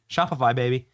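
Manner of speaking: ordinary talk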